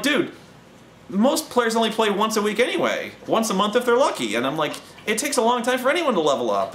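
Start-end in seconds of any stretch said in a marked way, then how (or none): 0.0-4.3 high-pitched, incredulous pushback; mock-pleading "come on, dude" protest; retold exasperated retort. Retold arguing back at coordinator. 5.1-6.7 high-pitched argument thrown back; imitating yourself pleading the obvious; retold exasperated retort. Retold arguing back at coordinator.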